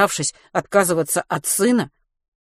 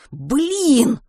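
high, drawn-out cry of frustration; frustrated exclamation; 9 semitones higher, 4 dB louder than usual. A narrator's reading. Boy cursing his blocked phone, frustrated. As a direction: curse your luck, pitched well up.

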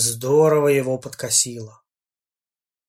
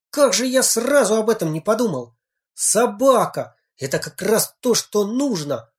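high, excited outburst; excited exclaim; 8 semitones higher than usual. Acted. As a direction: blurt it out with sudden delight. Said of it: "воскликнул он".

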